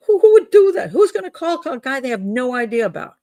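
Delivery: very high, loud exclamation of disbelief; incredulous. Real, not acted. Host disputing that anyone would call a stranger, incredulous. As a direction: burst out, astonished at the absurdity. Scoffing at an absurd story.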